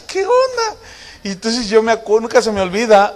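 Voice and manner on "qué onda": high-pitched, comic bafflement; mock-alarmed question; retold bewilderment. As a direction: squeak it out like a baffled husband. Baffled husband reacting, retold.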